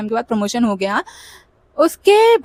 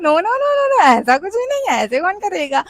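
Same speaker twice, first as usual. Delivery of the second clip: much higher, hurried protest; flustered denial; 7 semitones higher than usual. Retold or imitated. Answering her manager's accusation, high flustered denial. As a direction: deny it fast, caught out. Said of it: "I was like".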